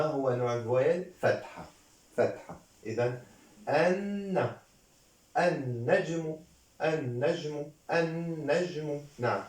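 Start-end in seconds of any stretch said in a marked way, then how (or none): 3.7-9.0 "النجم" as an-najmu, the n held long; shadda pronunciation. Shown as a demonstration. Teacher models how it is pronounced, long held n. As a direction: lean on the doubled n in "النجم". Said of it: "هكذا تنطق".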